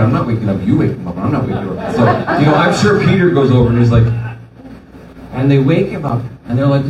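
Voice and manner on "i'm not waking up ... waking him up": lower, stubborn mock refusal; a half-laughing I'm not doing it. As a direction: dig your heels in, low and grudging. He voices himself as a reluctant disciple.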